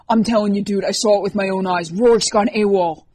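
loud, insistent shouting; screaming. A narrator's reading. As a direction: insist on it at full volume. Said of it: "went right back to screaming at Matt".

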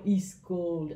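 put-on Transylvanian accent on "East cold"; vampire accent. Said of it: "my vampire accent".

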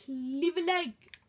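loud, slow put-on voice; cutesy plea. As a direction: beg in a silly voice.

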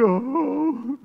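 high, slow, weepy mock read; mocking a believer who reads scripture without faith; whiny faithless reading. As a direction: read the verse like a whimper. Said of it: "Don't just read".

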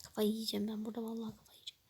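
frustrated whisper. Real, not acted. Vlogger whispering to viewers that she is losing her mind. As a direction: hiss the complaint, quick at the end. Frustrated aside after arguing.

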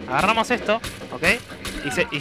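usual speaking voice

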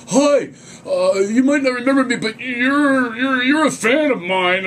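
high, loud, slow starstruck gushing; awkward fan gush. Retold or imitated. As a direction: gush at her like a nervous fool.

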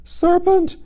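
very high, loud shriek; scream. A narrator's reading. Pigeon accuses Alice of being a serpent, screaming. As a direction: scream it like an alarmed bird. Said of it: "screamed the pigeon".

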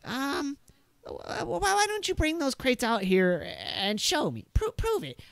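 a jittery gunman daring you to prove it; suspicious NPC voice. Acted. Game master voicing the gunman demanding proof, high and stammering. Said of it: "Uh, he's like".

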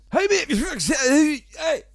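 loud, high broad Scottish yell of a mate; broad Scottish shout. Retold or imitated. A Scot unheard down south, shouting in broad Scottish. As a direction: bellow it in thick Glaswegian Scots. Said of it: "You don't sound very Scottish anymore".

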